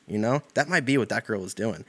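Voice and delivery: ordinary talk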